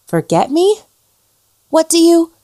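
high, rising startled question; jolted, cut off mid-word; alarmed. Acted. Ghost startled by the woman's words, high alarm.

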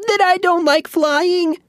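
high squeaky mouse complaint. Acted. Mouse Bobbin answering the wizard, squeaky complaint. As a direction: squeak it out, rattled. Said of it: "Bobbin said".

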